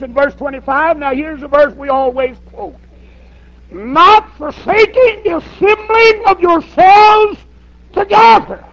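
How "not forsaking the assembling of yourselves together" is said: slow, high, shouted scripture; preaching at full force. Acted. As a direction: thunder the verse out to the church. Preaching the verse.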